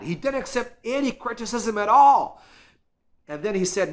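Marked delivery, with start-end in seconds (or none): none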